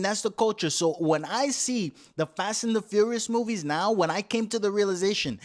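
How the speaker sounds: ordinary talk, nothing marked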